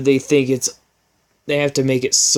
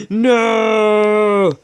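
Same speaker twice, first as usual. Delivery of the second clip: loud, high, hammed-up scream; mock-dramatic scream of denial; 9 semitones higher, 6 dB louder than usual. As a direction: cry out like a movie hero. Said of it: "I screamed".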